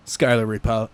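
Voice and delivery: ordinary talk, nothing marked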